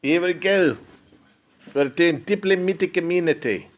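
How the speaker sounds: doing a mock South African diplomat; South African accent. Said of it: "We're going to do a South African accents here".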